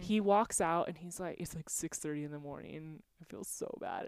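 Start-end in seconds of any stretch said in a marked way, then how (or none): 3.2-4.1 whisper. Retold or imitated. Recalling crying at dawn.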